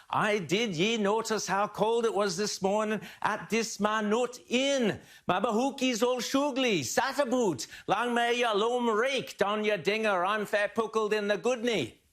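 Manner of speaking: thick, high Scottish brogue; broad Scottish accent. Acted. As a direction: ham up a broad Scottish drinker. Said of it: "Or words to that effect".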